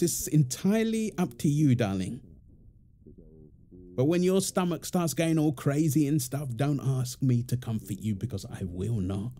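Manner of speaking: ordinary talk, nothing marked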